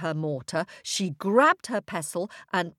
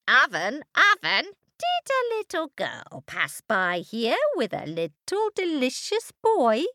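witch voice; 6 semitones higher than usual. A narrator's reading. Witch questions the oven.

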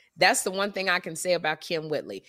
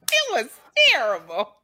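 ordinary talk, nothing marked

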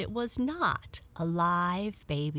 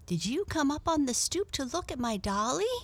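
lightly voiced little girl; 8 semitones higher, faster than usual. Little girl speaks to cat.

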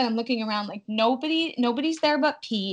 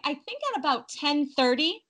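usual speaking voice

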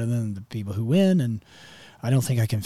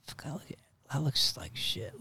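soft, half-whispered self-criticism; hushed, imitated dismay; muttered dismay; 5 semitones higher, 7 dB quieter than usual. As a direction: mutter it under your breath, deflated. Own reaction before dad's yell.

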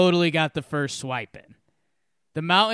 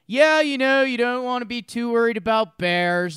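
higher-pitched Colorado local's laid-back speech; Colorado California accent; 6 semitones higher, 5 dB louder than usual. Retold or imitated. Locals giving newcomers the hiking speech, imitated Colorado accent. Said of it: "gave me the Colorado welcome to hiking speech".

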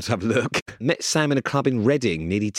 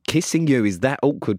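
ordinary talk, nothing marked

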